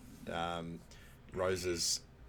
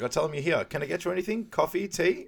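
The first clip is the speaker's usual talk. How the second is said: high, loud, helpful desk voice; eager receptionist; 8 semitones higher, 5 dB louder than usual. Retold or imitated. Receptionist offering coffee to the visitors, high and eager. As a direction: offer the drinks sweetly and high. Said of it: "He's like".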